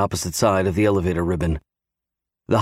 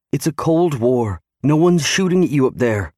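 higher-pitched wife, protesting; anxious wife arguing back; pleading wife; 6 semitones higher than usual. A narrator's reading.

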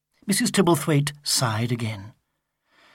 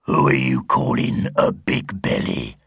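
very low, menacing monster voice; demanding wrestler. A narrator's reading. Monster Mash demanding of Sigurd, very low and threatening. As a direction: demand it like a huge brute. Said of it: "demanded Monster Mash".

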